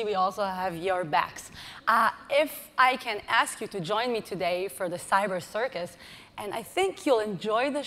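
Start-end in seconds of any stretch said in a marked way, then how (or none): none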